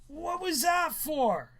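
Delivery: high, loud, slow protest; outraged high yell; indignant yelp. Acted. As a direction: cry out at the sudden smack. Hit on the head, protests.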